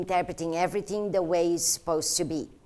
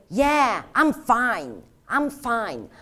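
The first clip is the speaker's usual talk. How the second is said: insistent fine; slower than usual. Shown as a demonstration. Trainer showing how inflection changes meaning, insistent and raised. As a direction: punch out each fine as if brushing off concern. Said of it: "There's a huge difference when you say".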